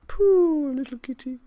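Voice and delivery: put-on high, loud old woman cooing; old woman cooing over the scraggly kitten; tender old woman. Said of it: "said the very old woman".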